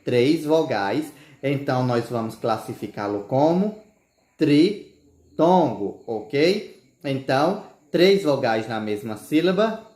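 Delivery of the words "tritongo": "tritongo" stretched slowly, split after "tri"; drawn-out term. Shown as a demonstration. Teacher introducing the term to pupils, slow and segmented. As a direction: pause after "tri", then finish "tongo".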